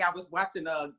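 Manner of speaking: ordinary talk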